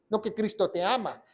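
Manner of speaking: normal talking voice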